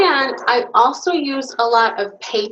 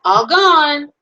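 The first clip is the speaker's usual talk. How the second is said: trumpeting; 6 semitones higher, 5 dB louder than usual. Acted. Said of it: "I trumpet".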